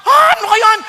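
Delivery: loud protest that starts high and comes down; mock angry objection. Imitating couples objecting to leave and cleave, loudly indignant. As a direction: rant back in exasperation, loudly. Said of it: "Nagagalit agad".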